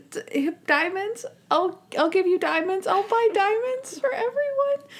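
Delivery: imitating a very high, giddy offer; hammed-up high-pitched babble; Monk. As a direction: squeak it out, hesitating at first. Monk babbling giddily about diamonds.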